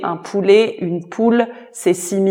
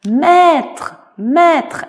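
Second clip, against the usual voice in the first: high, loud shout of master; calling out; 9 semitones higher, 7 dB louder than usual. Acted. Gretel cries out to her master in alarm. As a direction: call out in alarm. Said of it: "elle a crié".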